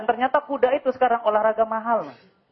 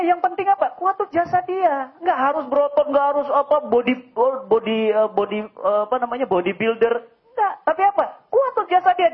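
ordinary talk

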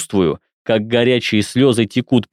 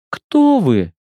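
put-on high, scared girl asking; frightened young woman; 8 semitones higher than usual. A narrator's reading. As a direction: voice her asking again in fear. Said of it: "повторяет она".

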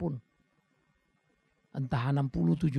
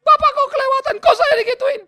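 high, loud tearful whining; whiny crybaby; 12 dB louder than usual. Retold or imitated. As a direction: mimic a sobbing complainer, hammed-up. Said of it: "marahin orang cengeng". (menirukan orang cengeng yang merengek sambil menangis)

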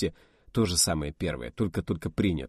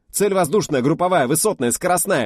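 rattling off; 10 semitones higher, 8 dB louder than usual. Acted. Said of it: "затараторил по новой".

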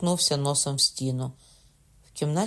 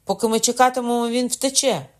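high, fast, loud urging; impatient; 9 semitones higher, 6 dB louder than usual. A narrator's reading. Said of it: "скрикнув Кокона".

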